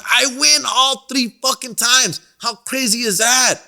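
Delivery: excited brag. Real, not acted. Boasting about winning three fights.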